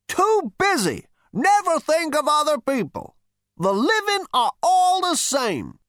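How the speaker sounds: high, loud cantankerous rant; scolding old man. Acted. Great-grandfather scolds neglectful Zaphod, high and indignant. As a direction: berate him in a high elderly voice.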